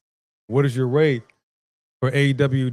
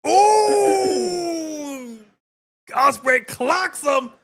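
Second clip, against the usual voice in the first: high, frantic excited yells; excited yelling; 14 semitones higher, slower than usual. Real, not acted. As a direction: yell for the missing ref. Frantic no-ref moment.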